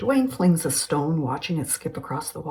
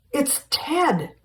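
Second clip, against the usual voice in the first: high excited call; excited spotting; 10 semitones higher, 4 dB louder than usual. Acted. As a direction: point and shout with relief. Spotting Ted returning.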